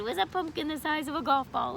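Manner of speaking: plain reading voice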